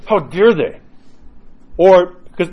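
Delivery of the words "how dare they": loud, offended outburst; mock indignation. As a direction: act out taking offence, with force. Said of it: "because why are we mad?".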